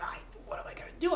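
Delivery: fast, hushed whisper of dismay; retold whispered panic; panicked whisper. Panicked realization about eating cows.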